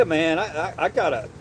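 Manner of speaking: normal talking voice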